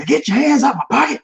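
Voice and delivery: high-pitched outburst from the crowd; Malcolm X movie line. Retold or imitated. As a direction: call it out high, quoting a film. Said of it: "what was that from Malcolm X?".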